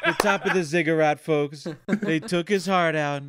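laughing Trump impression, loud; rally Trump, gleeful.

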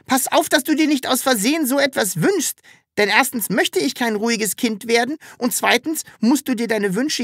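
high-pitched little creature voice; high, insistent imp voice; cheeky Sams creature, urgent. A narrator's reading. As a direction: warn eagerly in a high childlike pitch. The Sams warns Papa.

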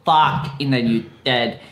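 put-on low, thick Russian accent; lightly put-on Russian accent. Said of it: "Your Russian accent has slipped a little bit".

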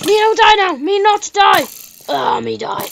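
loud high mock caveman pleading; caveman speech. Gamer dying in the cave, loud mock caveman voice. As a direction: play a dumb caveman begging to live.

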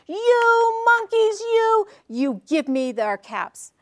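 loud, high, drawn-out mock fury; mad peddler. A narrator's reading. Peddler loses his temper at copycat monkeys, storybook anger. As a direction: burst out high on the first words, slowly scolding. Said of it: "he got so mad".

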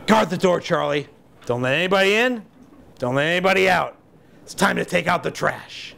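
deliberate orders, one phrase at a time; giving orders. Acted. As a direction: lay down the plan like a bandleader. Mick gives Charlie his orders.

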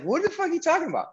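high, fast, incredulous yelp; stunned disbelief. Retold or imitated. Told Denzel is aboard, incredulous high yelp. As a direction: blurt it out, voice jumping up in shock. Stunned reply to shocking news.

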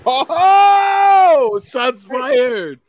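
high, loud gleeful whoop; excited shout. Real, not acted. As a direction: yelp with delight at the dig. Reacting to a playful jab.

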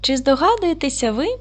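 ordinary delivery

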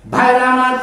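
preaching. Acted. Preacher calls out to crowd.

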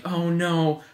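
normal talking voice

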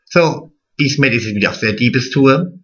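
ordinary talk

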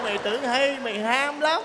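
normal talking voice

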